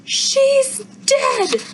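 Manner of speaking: high shriek of horror; scream. A narrator's reading. Izzy finding the possum, a high horrified scream. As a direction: scream it out in shock. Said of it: "Izzy screams".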